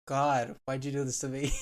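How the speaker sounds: sighing through tears; tearful. Real, not acted. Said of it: "Don't cry".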